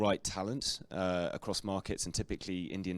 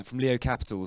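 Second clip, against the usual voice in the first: ordinary delivery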